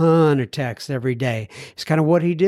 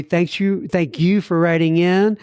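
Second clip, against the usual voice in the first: ordinary talk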